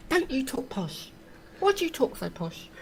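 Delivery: high mocking schoolyard taunt; mocking posh accusation. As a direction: mimic kids jeering at posh talk. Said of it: "people used to take the mickey out of me".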